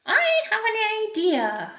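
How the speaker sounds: high, bright-voiced little pig with an idea; pig character voice. Teacher reading to the class voices a pig, high and eager. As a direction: pipe it up high like an eager piglet.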